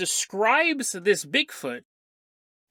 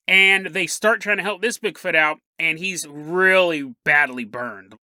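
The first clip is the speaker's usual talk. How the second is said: normal talking voice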